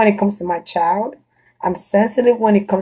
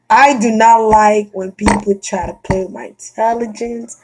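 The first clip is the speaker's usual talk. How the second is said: ordinary delivery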